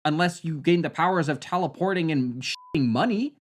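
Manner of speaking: normal talking voice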